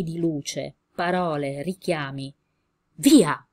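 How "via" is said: loud sharp command to flee; urgent exclamation. Acted. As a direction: spur them on with one loud word. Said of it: "esclamò Ettore spronando".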